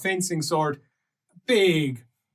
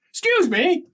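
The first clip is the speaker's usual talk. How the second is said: high, raised call; pushy "excuse me"; 13 semitones higher, 4 dB louder than usual. Acted. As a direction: call out as you barge through. Guard pushes his way in.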